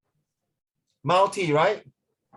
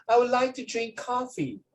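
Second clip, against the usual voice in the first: posh high-class lady; 7 semitones higher than usual. Retold or imitated. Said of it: "You must be, like, high class".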